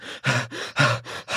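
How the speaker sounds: long breathy sigh; exhausted sigh. Retold or imitated. Retelling the near-drowning swim, a spent exhausted sigh. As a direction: let all the air out, spent. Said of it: "I'm just gasping for air".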